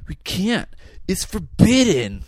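put-on high, cracking weedy teenager voice; drawn-out surfer-voiced teen cult enforcer; Malachi. Host debuts his new impression for co-host, breaking teen voice. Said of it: "I've been working on my Malachi impression".

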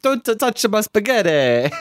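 whiny high cartoon Italian accent; mock Italian. Guarding his spaghetti from co-host, mock Italian accent. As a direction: plead over the spaghetti in a jokey Italian accent. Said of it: "That was my Italian".

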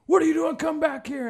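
high loud call after someone; bouncer yelling. Retold or imitated. Bouncer yelling at a runaway, loud and high. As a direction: yell after a guy running off. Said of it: "he goes".

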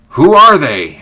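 high, loud, alarmed demand; urgent question. A narrator's reading. George straining to see what is coming, urgent question. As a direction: fire the question out, falling hard at the end. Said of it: "asked George quickly".

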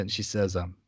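ordinary delivery

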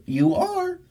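very high coo. Real, not acted. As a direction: gush at the dog way up high. Talking to her dog.

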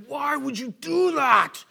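loud, high, slow retold yell; father in pain yelling at his daughter, angrily; angry outburst. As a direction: blast it out in sudden pain and anger. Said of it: "super intense and angry".

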